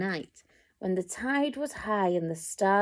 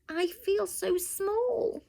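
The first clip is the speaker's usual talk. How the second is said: high, slow little snail voice; tiny snail voice; 10 semitones higher, slower than usual. A narrator's reading. Snail confessing to the whale, small and high. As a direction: make the snail sound tiny and timid. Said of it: "said to the whale".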